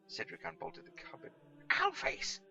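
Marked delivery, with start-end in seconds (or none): 1.7-2.4 high, loud indignant cry; furious insult. Acted. Catweasel insults Cedric opening the cupboard, high loud outrage. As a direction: fling the insult at him. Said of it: "said Catweasel".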